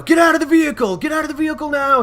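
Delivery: high, loud barked command; cop shouting. Retold or imitated. Cop ordering driver out of the car, loud shout. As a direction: yell it like a cop with a gun drawn. Cop yelling with gun drawn.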